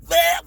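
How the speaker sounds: high, loud strangled bleh; mock choking gag. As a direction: gag like being clotheslined, hammed up. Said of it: "She was like".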